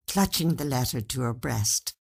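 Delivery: ordinary delivery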